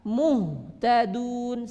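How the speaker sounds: Muhtadun drawn slowly, lips pushed forward; wrong rounded muhtadun. Shown as a demonstration. Teacher shows the mistake again.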